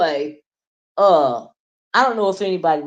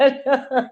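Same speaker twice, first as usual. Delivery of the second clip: a quick real laugh, higher; short laugh; 7 semitones higher than usual. Real, not acted. Vlogger laughs again mid-sentence to her viewers. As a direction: chuckle through the word. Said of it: "I'm laughing because".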